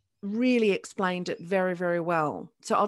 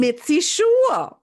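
"Mitzi Shore" said slowly, much louder and higher, each word hit; emphatic name correction; 8 semitones higher, 11 dB louder, slower than usual. Real, not acted. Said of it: "Her name is Mitzi Shore".

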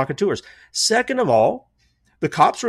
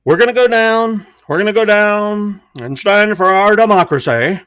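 loud, raised bad Trump voice; Donald Trump impression; 5 semitones higher, 7 dB louder than usual. Mocking Trump to his viewers, loud impression. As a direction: ham up a rally speech, louder. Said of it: "I know that's not a good impression of, of Donald Trump".

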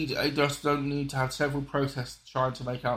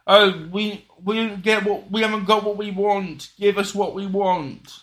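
higher, louder imitation of demanding activists; mock protesters; 6 semitones higher, 7 dB louder, slower than usual. Imitating protesters who sit there and say it, mock demand. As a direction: whinge it out like a mocked protest crowd. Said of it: "to sit there and say".

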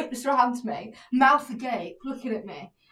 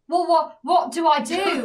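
louder, higher panicked wail; 4 semitones higher, 5 dB louder, slower than usual. Retold or imitated. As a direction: act out helpless panic. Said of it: "I see it and I panic".

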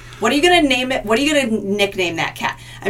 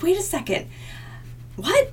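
confused surprise; 10 semitones higher, 6 dB quieter than usual. Retold or imitated. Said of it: "you'd be like".

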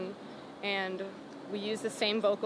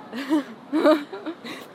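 laugh; 6 semitones higher, 5 dB louder than usual. Real, not acted. Laughing at own bossy text.

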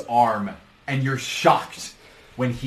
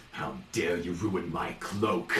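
indignant villain; 6 semitones lower, 7 dB quieter, slower than usual. Acted. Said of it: "and he's like".

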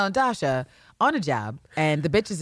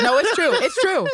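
high insistence; 10 semitones higher, 4 dB louder than usual. Real, not acted. Emphatic insistence.

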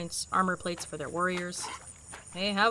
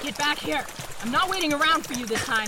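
higher, urgent call; pitched-up, quick threat on the run; calling after prey; 6 semitones higher than usual. Acted. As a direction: chase it down with your voice. Hunter calling after fleeing worm.

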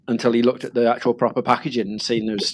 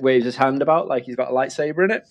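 ordinary talk

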